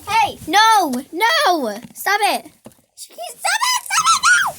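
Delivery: high, loud yells at the dog; yelling. Real, not acted. Yelling at her dog Sophie, loud shouts.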